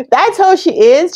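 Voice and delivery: said through laughter, high and slow; amused, still giggling; laughing. Real, not acted. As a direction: laugh as you say it. Laughing while speaking.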